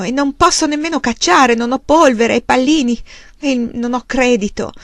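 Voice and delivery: desperate anger. Acted. Husband bangs the table and shouts at his wife. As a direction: burst out in helpless fury. Charles after hitting table.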